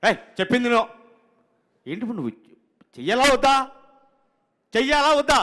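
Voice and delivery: high, loud outburst, building on repeats; irate director. Retold or imitated. Director demands whether to shoot or not, irate. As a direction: imitate a boss yelling in frustration. Acted director losing his temper.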